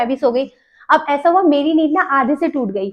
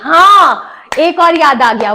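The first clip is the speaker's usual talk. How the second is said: sudden recollection; 2 semitones higher, 6 dB louder, slower than usual. Real, not acted. Vlogger remembering another story, loud sudden excitement. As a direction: exclaim as a memory pops up.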